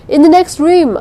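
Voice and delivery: loud, high frantic call; woman calling wildly to the searchers. A narrator's reading. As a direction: shout wildly over the noise. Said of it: "I called wildly".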